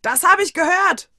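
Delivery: very loud, high angry yell; loud indignant bellow. Acted. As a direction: roar it back from the kitchen. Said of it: "brüllte ich noch".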